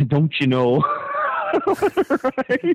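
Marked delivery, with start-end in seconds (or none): 0.1-0.8 light mock Wisconsin accent; northern US accent. Host echoing co-host's joke, light northern accent. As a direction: echo it with a touch of Upper Midwest accent. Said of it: "Is that a Wisconsin thing?".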